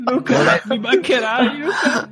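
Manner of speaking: mock farewell. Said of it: "Ma allora è finto".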